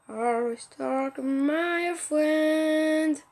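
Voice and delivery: extremely drawn-out bad English accent; rotten English accent. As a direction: stretch every word of terrible French-accented English. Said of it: "J'ai un accent pourri". (anglais à l'accent pourri, interminable)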